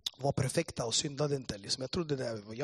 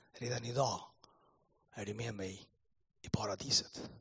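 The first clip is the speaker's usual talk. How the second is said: soft, lowered, tender reply; gentle promise; 5 semitones lower, 6 dB quieter than usual. Retold or imitated. Jesus answering the thief on the cross, gentle and soft. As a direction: promise it quietly, pausing between phrases.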